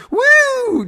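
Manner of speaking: very high, loud woo; taunting high whoop; obnoxious whoop. Retold or imitated. Runner jeers at the driver, obnoxious whoop. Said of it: "really obnoxious".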